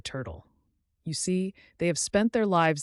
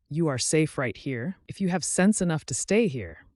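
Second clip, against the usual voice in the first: testy; 4 semitones higher, 7 dB louder than usual. A narrator's reading. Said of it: "declared Jerry Muskrat rather testily".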